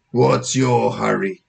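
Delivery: slow, measured officer voice; suspicious policeman. Acted. Officer stopping boy, slow suspicious voice. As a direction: question him slowly, as a wary cop.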